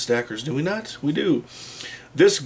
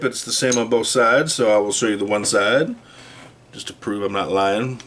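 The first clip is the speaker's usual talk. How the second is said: normal talking voice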